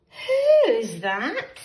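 loud curious who's that. A narrator's reading. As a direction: call it out with suspense. Suspense refrain in story.

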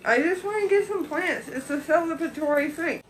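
muffled behind mask; through a face mask. Real, not acted. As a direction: mask covering mouth. Seen 0: Speaker in pink shirt wears pink mask over mouth throughout.

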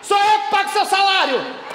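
sharp shouted rebuke, pitch up; furious yell at the nurse; angry outburst. Retold or imitated. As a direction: bark the order at her. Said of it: "olhei pra cara dela e falei".

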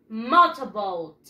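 MOTORBOAT slow, "mo-to-bout", falling low at the end; pronunciation model. Shown as a demonstration. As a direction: say MOTORBOAT drawn out for a class to repeat, pitch dropping. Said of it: "Repeat after me".

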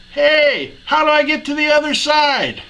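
yelling across. Acted. First blonde to another across the lake, high yell. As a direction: holler to someone on the far shore. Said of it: "yells across the lake".

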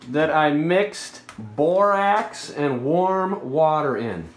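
usual speaking voice

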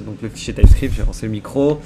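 bellowing a bit into the mic; too loud. Real, not acted. Streamer forgetting the mic is on, too loud. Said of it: "Je suis pas obligé de gueuler comme un bœuf".

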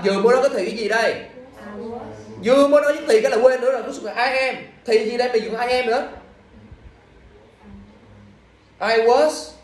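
exasperated scolding. Real, not acted. Teacher nagging students who keep forgetting the tense, exasperated.

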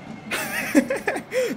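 high giggle at the keeper; soft high-pitched chuckle; high amused laugh. Real, not acted. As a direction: laugh in delight at the shot. Laughing at the goalkeeper.

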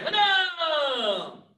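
shouted drill count; sharp martial arts commands. Real, not acted. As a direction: bark the count to the class. Instructor counting kicks.

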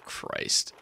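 breathless whisper. Real, not acted. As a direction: whisper it under your breath. Shocked after a game scare.

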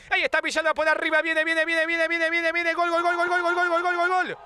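rising high, rapid-fire goal call; breakneck goal commentary. Real, not acted. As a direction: shout the goal, building to a peak. Goal scored, commentator calls it.

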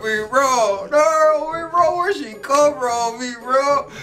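high, hyped-up imitation of a friend; happy partner. Happy partner urging they go after her, imitated excitedly. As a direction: mimic his glee, pitched well up. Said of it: "he happy".

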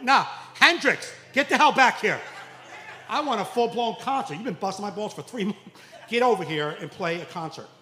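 dad hollering at son to play; mock yell. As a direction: call him back like a furious rock fan.

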